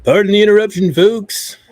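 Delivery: high, loud call to the audience; loud announcement. Real, not acted. Host apologizing to folks after a phone interruption, loud announcement. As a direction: project an apology out to the whole crowd.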